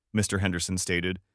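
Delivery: ordinary delivery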